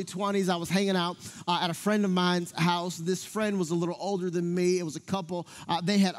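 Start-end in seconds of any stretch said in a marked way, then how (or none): none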